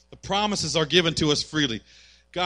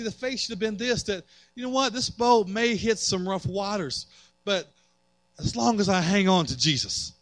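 ordinary talk

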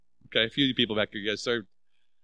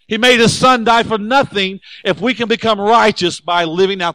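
louder, higher and slower, driving every phrase home; preaching at full force; 6 semitones higher, 7 dB louder than usual. Acted. Pastor hammering the point to his congregation, full-force preaching. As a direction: preach it hard to the back row.

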